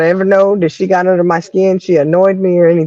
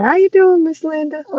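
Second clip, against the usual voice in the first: cheery greeting; 9 semitones higher than usual. Retold or imitated. Greeting rude Miss Linda while always smiling, cheery. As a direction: greet them with sugary, killing-with-kindness cheer.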